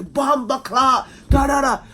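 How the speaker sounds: high, loud, drawn-out Jamaican shout; mock Jamaican exclamation. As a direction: shout it like the yardie shop crowd. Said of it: "They come out and say".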